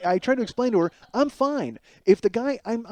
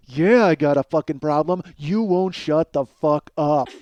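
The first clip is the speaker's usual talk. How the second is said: louder, slow furious snarl; angry retort; 6 dB louder, slower than usual. Retold or imitated. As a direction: hit back hard and slow. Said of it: "And I go".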